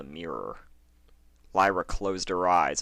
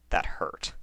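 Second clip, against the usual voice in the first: plain reading voice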